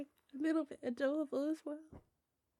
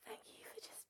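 whisper; 22 dB quieter than usual. Real, not acted. Tender thanks to lover.

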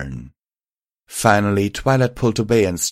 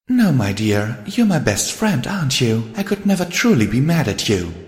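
higher, earnest character voice; reassuring lady, pitched up; caring friend Rarity; 5 semitones higher than usual. Acted. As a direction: coax a troubled friend to open up.

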